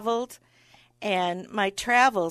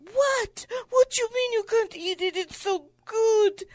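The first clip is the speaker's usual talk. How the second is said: offended French waiter; 14 semitones higher, 3 dB louder than usual. Retold or imitated. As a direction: squeal it like a deeply offended Parisian waiter. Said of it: "And he was so offended".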